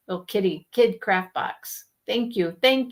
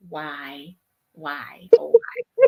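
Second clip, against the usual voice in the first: whispered lament; 7 semitones lower, slower than usual. Retold or imitated. Mock lament at cows.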